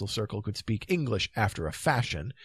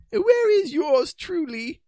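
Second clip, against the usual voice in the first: high, slow, formal question; dazed knight; 7 dB louder, slower than usual. Acted. Knight reviving after a faint, high and dazed. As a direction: come round slowly in a high voice. Narrator voices reviving Palomides.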